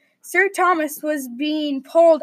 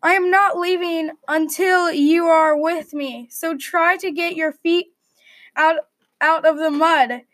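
plain reading voice

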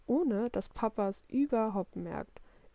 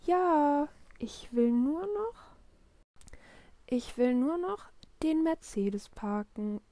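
put-on higher, drawn-out kid's excuse; stalling child; 6 semitones higher, slower than usual. A narrator's reading. Willi wants to park his Mercedes, stalling child. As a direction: put Dad off, stretching the words.

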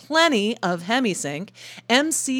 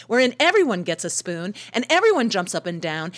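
ordinary delivery

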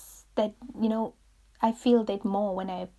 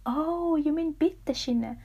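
high, raised-voice "oh, I get it"; imitated penny-drop reaction, pitched up; dawning realization; 7 semitones higher, 4 dB louder, slower than usual. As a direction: act out suddenly working out what was meant. Acts out listeners understanding her.

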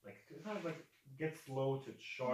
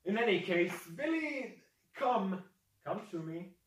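high, loud coaxing call; raised character voice, beckoning; calling a pet; 9 semitones higher, 8 dB louder than usual. Acted. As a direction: summon your big beast to your side. Figure calls Billy over like a pet.

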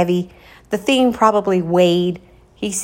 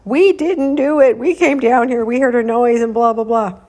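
higher, louder tearful pleading; crying excuses; 5 semitones higher, 6 dB louder than usual. Retold or imitated. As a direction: blurt out excuses near tears. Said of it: "like, crying".

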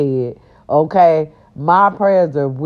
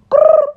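playful growl; 7 dB louder than usual. Pretend. Growl sound.

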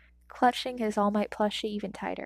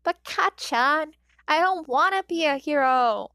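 put-on high, slow childish whine; whining; 6 semitones higher, 7 dB louder, slower than usual. A narrator's reading. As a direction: whine like a hurt little boy. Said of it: "Izuku whines".

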